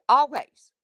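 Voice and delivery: "Always" punched high and loud; emphatic always. Real, not acted. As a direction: lean hard on "Always", insisting. Insisting her voice was always deep.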